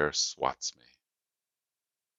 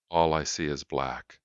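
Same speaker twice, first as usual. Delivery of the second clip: usual speaking voice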